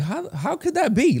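amazed woman. Retold or imitated. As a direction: toss it up like she can't believe it. Voicing the woman's amazed question.

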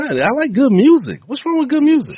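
mock innocent. Teasing the group, mock naive.

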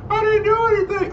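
very high falsetto wail; mock scream. Co-host mimicking his friend pleading innocence under arrest, mock screaming. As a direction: squeal it as a hammed-up plea. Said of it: "Dubs was screaming".

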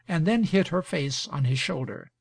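ordinary delivery, nothing marked

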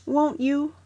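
high, hopeful little plea; wistful. A narrator's reading. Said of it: "said Teddy wistfully".

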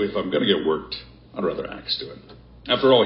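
ordinary delivery

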